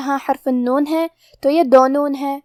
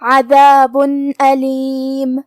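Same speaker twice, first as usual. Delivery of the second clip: slow recited عذاب علیم, noon clear; tajweed recitation; much slower than usual. Shown as a demonstration. Teacher demonstrating izhar, slow recitation. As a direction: recite slowly, sound the tanween. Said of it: "تنوین ظاہر کریں گے".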